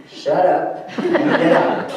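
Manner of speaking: quoting a rude doctor while laughing; laughing through quote. Retold or imitated. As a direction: break into laughter on the punchline.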